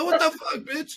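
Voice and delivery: high-pitched exasperated disbelief; hammed-up indignant squawk; mock outrage. Retelling reaction to pedantic Americans, mock outrage. Acted outrage at being misunderstood.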